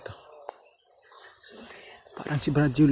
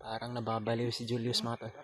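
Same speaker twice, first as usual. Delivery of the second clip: hushed commentary while hidden; whispering while hiding; 2 semitones lower, 11 dB quieter than usual. Real, not acted. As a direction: say it softly to stay hidden. Hiding from enemies, quiet.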